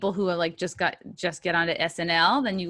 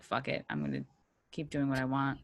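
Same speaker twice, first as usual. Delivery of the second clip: low offhand quote; 5 semitones lower, 8 dB quieter than usual. Retold or imitated. Voicing a defiant attitude for guest.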